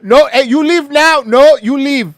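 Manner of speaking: put-on loud, high Indian shopkeeper accent. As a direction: shout it as an Indian store clerk. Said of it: "sounds like an Indian guy that owns a seven eleven".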